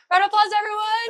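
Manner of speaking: big announcer call for applause; loud call to audience. Real, not acted. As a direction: hype up the crowd. Host calling for applause.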